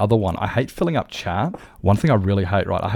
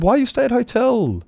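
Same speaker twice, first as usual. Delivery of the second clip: high-pitched quoted question; put-on high voice, drawn-up tune; acted question. Streamer retelling his question to a driver, high mock asking.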